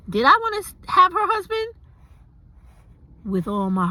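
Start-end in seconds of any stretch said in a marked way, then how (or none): 0.1-1.7 swooning squeal. Real, not acted. Swooning over friend's husband.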